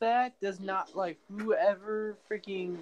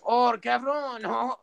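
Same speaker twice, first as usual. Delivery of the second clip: hammed-up Mexican accent, higher; mock Mexican accent; 5 semitones higher, slower than usual. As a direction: toss it off in a cartoonish Mexican accent. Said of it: "that was more Mexican".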